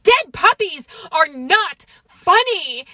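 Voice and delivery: slow, emphatic mock outrage; angry dentist. Dentist scolding giggling room, angry and emphatic. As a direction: scold it slowly, stressing every word. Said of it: "he was so angry".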